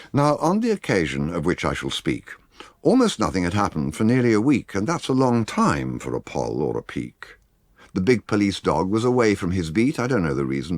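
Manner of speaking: ordinary delivery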